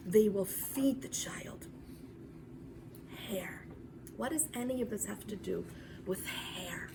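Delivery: soft hushed whisper. Acted. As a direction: draw them in with a whisper. Hushed turn to mystery.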